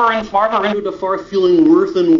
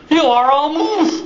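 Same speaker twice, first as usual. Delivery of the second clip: high, slow and louder pronouncement; loud declaration; 9 semitones higher, 4 dB louder, slower than usual. Acted. Moose proudly tells the duckling what it is, slow loud pronouncement. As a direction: announce it grandly and slowly.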